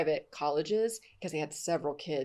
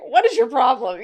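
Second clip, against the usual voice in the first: high, loud mocking question through laughter; laughing incredulity; 8 semitones higher, 14 dB louder than usual. Law enforcement friends mock her tears, laughing loudly. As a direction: tease her like a puzzled cop, laughing. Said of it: "They're like".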